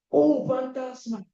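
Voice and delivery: high, slow, fearful gasp of a word; a disciple's terrified cry; frightened cry. Acted. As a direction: cry it out as if seeing a ghost. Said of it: "dieron voces de miedo".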